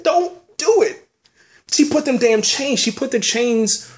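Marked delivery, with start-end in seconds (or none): 0.0-1.1 high, slow mock plea; pleading with Khaleesi, high and slow; retold plea. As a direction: replay the warning in a high, drawn-out voice. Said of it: "I said".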